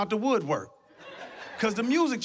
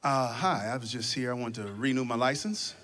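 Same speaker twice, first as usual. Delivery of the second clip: low, hesitant, meek customer voice; timid customer asking the clerk to renew, low and meek; 8 semitones lower, 3 dB quieter than usual. Retold or imitated. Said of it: "the conversation goes something like this".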